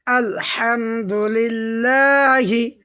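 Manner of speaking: আলহামদুলিল্লাহি recited very slowly, syllable by syllable; drawn-out recitation; slow Quranic recitation. Shown as a demonstration. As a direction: chant আলহামদুলিল্লাহি slowly like tajwid practice. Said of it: "দ্বিতীয়বার উচ্চারণ করবে এইভাবেই".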